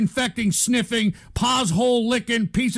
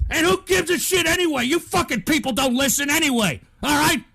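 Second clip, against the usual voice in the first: high, raised voice, fed up; angry rant; 5 semitones higher than usual. Real, not acted. Streamer to viewers who don't listen, angry and high. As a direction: throw it at people who never listen.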